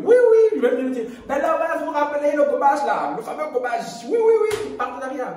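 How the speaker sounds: high, fast, laughing hype; mock excited announcement. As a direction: ham up the sponsor reveal. Imagined future sponsorship announcement.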